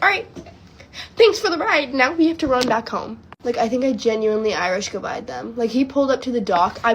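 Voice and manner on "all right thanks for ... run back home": high, loud mock-tearful goodbye; retold sobbing. Thanking rescuers before running home, retold sobbing. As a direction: imitate a sobbing, hurried thank-you. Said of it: "sobbing, being like".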